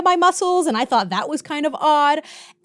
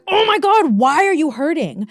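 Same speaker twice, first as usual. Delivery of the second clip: high, raised mock cry of frustration; hammed-up exasperation; exasperated outburst; 4 semitones higher than usual. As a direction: complain at your own aching body. Retold outburst at aching body.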